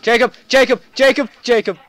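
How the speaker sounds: high, loud, rapid-fire shouts of a name; urgent calling out. Real, not acted. Player calling teammate Jacob repeatedly, urgent shout. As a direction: yell the name over and over.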